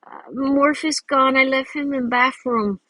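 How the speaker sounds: put-on a seven-year-old confessing the loss; little kid voice. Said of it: "I'm like".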